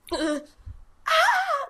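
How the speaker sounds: gasp, then high mock scream; short high-pitched scream, acted out; imitated loud scream. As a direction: reenact a startled shriek, very high. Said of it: "like, so loud".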